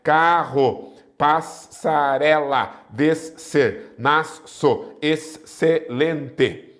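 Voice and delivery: "Carro, passarela, descer, nasço, excelente" cut into slow separate syllables. Shown as a demonstration. Teacher showing where digraphs split, syllable by syllable. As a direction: break each word between the double letters, pausing. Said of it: "Separam-se as letras".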